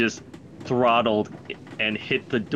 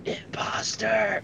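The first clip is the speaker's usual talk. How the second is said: slow, higher, breathy stage whisper; joking whisper; 9 semitones higher, 5 dB quieter, slower than usual. Retold or imitated. Out of character, Riley accusing Zira, stage whisper. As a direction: hiss the accusation as a joke. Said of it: "Riley's like".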